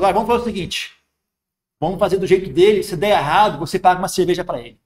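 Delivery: monk to arguing builders, easygoing and playful; jovial peacemaking. Retold or imitated. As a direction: defuse the quarrel with a joke. Monk calming two quarrelling workers.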